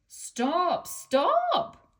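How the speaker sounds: very high loud frightened shriek. A narrator's reading. Annie and Clarabel shrieking at runaway Thomas, loud shriek. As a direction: scream it as the coaches run away. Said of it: "shrieked Annie and Clarabel".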